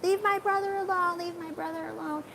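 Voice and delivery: high-pitched pleading, fading to a quick hush; mock yell rising high then dropping soft and fast; retold plea. A girl pulling her stepsister off her brother, high pleading. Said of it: "I was like".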